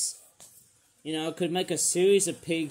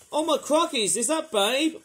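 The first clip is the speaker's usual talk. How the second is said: high, loud oh my crockies; surprised; 9 semitones higher, 3 dB louder than usual. Real, not acted. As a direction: gasp at a surprise. Surprise at the packaging pig.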